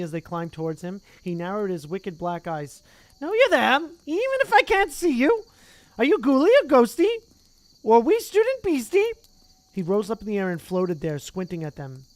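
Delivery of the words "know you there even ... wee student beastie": high, loud, impish sing-song; cackling poltergeist. Acted. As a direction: tease like a mischievous ghost. Peeves voiced in story.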